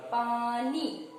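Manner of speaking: पानी with both vowels held, "paa-nee"; demonstrated whole word. Shown as a demonstration. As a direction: sound out पानी syllable by syllable. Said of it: "उसका उच्चारण क्या होता है". (पानी की दोनों मात्राएँ खींचकर बोलें)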